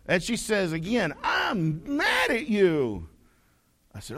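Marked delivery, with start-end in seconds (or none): 1.2-3.1 put-on slow, drawn-out little-kid huff; mock pouty anger. Little girl tells preacher off, mock pouty anger. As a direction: draw out MAD like a cross small child. Child repeats her anger.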